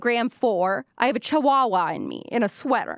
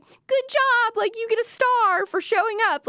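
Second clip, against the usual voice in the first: high, louder sing-song participation-trophy praise; patronising mom; 14 semitones higher, 4 dB louder than usual. Retold or imitated. Moms praising their kid just for showing up, high and sing-song. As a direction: praise her like a mom handing out a gold star.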